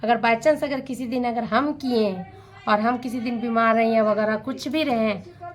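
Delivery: ordinary talk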